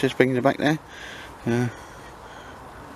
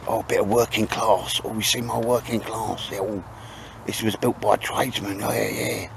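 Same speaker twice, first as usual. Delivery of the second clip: mock posh yuppie; 5 dB quieter than usual. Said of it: "all the yuppies and that".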